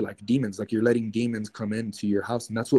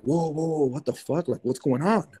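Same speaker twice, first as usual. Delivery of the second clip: retold alarm; 5 semitones higher than usual. Retelling his alarm at dogs.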